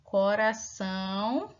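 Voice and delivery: Coração said slowly, each syllable clear; slow clear reading. Shown as a demonstration. Teacher modelling Coração for children, slowly. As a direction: draw Coração out for the class. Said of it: "Temos aqui também coração".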